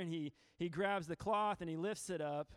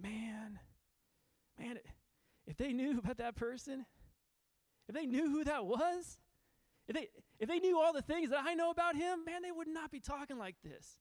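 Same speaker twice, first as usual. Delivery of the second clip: a soldier's hurt protest, rising much higher; emotion welling up; 7 semitones higher than usual. Acted. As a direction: let feeling build under the words, pitch climbing as it goes. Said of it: "all this emotion is just welling up inside him".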